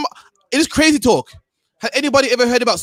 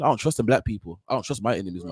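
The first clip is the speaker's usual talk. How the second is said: a lower, quieter mock boss mutter; mock boss, dropped low; 10 semitones lower, 7 dB quieter than usual. Mocking a Chinese boss's private thoughts, said low and quiet.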